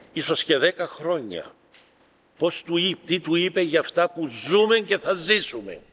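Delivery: normal talking voice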